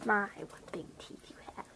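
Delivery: a hushed whisper, mock awe. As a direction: whisper it to the wolf. Her character speaks to the wolf.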